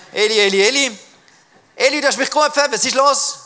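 put-on high, loud boy calling out; urgent calling. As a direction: call out eagerly to wake someone. Young Samuel wakes and runs to Eli.

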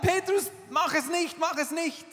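high, loud urgent calls; pleading shout. Acted. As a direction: shout to stop him, easing off. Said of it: "Diese Stimmen sagen".

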